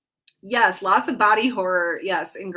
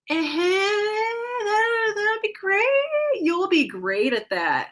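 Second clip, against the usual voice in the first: strained, slow, high and uneasy "that'd be great"; imitating a nervous friend's polite but doubtful encouragement; forced awkward praise; 13 semitones higher, 3 dB louder, slower than usual. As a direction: fake enthusiasm that cracks, dragging the words out. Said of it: "in that tone of voice".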